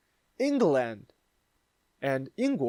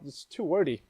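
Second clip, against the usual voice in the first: ordinary delivery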